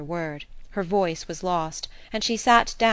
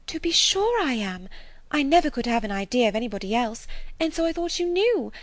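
a lightly voiced eager young woman, a bit higher; excited Harriet; 9 semitones higher, 3 dB louder than usual. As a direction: gush the news breathlessly like a gossiping girl.

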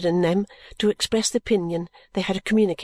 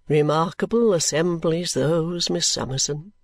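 put-on lower, slow and reverent elderly gentleman; reverent Mr Badger; 4 semitones lower than usual. Mr Badger reverently praising the evenings to Miss Summerson, lower and slow. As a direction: speak with hushed reverence of a great man. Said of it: "said Mister Badger reverentially".

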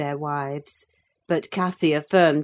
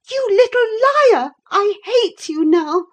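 put-on high, loud, furious girl; panting with passion; 9 dB louder than usual. A narrator's reading. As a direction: explode at your cousin in high-pitched rage. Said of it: "she panted".

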